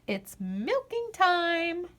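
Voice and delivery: drawn-out singsong refrain; lilting storybook refrain; slow refrain. A narrator's reading. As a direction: stretch it out like a chorus. Refrain read slowly.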